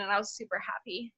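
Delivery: whispered softly. Real, not acted. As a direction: breathe it out in a whisper. Whispered aside.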